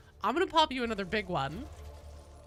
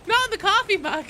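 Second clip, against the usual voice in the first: dismayed yelp; 9 semitones higher, 7 dB louder than usual. Real, not acted. Confetti hits coffee mug.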